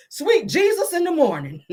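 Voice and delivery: a prim man gasping in horror. Retold or imitated.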